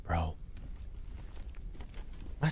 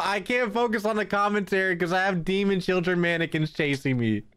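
normal talking voice

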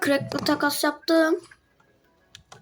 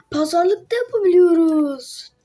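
loud, slow, amazed; excited; 5 semitones higher, 6 dB louder, slower than usual. Real, not acted. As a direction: savour the discovery. Discovers bargaining option. (heyecanla yavaşça)

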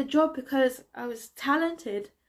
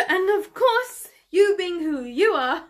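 higher, louder, starting on a laugh; scoffing laugh; 5 semitones higher, 6 dB louder than usual. Acted. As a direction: laugh as you throw it at them. Bitter confrontation over an old encounter.

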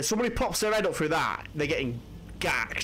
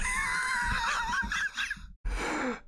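very high cackling laugh; helpless squealing laughter; high shrieking laugh; 4 dB quieter than usual. Real, not acted. Laughing at own blunder.

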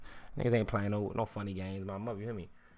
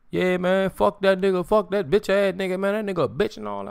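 higher, louder ranting put-on voice; mock trash-talk rant; 6 semitones higher, 3 dB louder than usual. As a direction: act out a hyped-up trash talker. Voicing a hypothetical trash-talk video.

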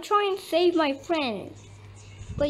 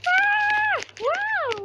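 very high, drawn-out scream; high squeal. Pretend. Player reacting to game action, high squeal.

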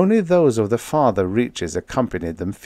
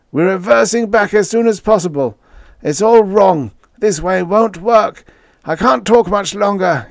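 high, strained, louder plea; desperate wheeze; 12 semitones higher, 6 dB louder than usual. A narrator's reading. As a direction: fight to get the words out. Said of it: "he wheezed".

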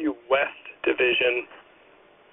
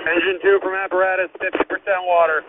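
urgent firefighter radio; 5 semitones higher than usual. Real, not acted. Fireground radio status call.

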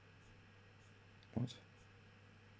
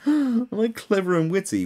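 higher, louder self-satisfied tone; mock smug voice; 13 semitones higher, 7 dB louder than usual. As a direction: preen like a show-off. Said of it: "I'm like".

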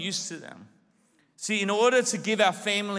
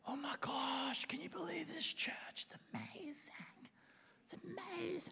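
whispered, higher, awed gush; 9 semitones higher, 15 dB quieter than usual. Retold or imitated. New churchgoer, whispering in awe. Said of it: "What happened?".

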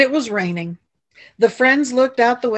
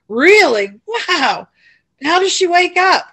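rising, louder wonder; excited; 9 semitones higher, 6 dB louder than usual. A narrator's reading. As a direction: gasp with eager curiosity. Max excited by sleeping story.

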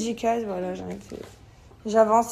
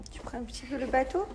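whisper; 6 dB quieter than usual. Real, not acted. Whispering to baby at play.

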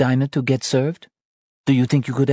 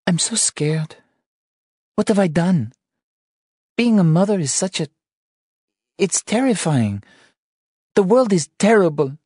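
much higher, louder frightened wife; scared crying; 14 semitones higher, 4 dB louder than usual. Acted. Wife confessing her fear of motherhood, tearful and scared. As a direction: pour out fear through tears. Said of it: "She cried".

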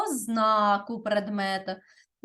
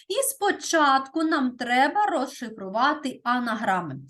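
normal talking voice

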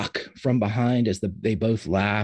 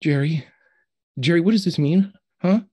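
higher, louder, urgent questioning; anxious pleading; 8 semitones higher, 5 dB louder than usual. Acted. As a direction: press a friend for answers, voice rising. Ben anxiously pressing Jerry for answers.

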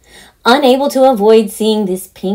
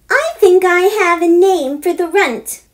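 put-on eager girl; 10 semitones higher, 4 dB louder than usual. Daughter announcing her audition idea, eager. Heather voiced.

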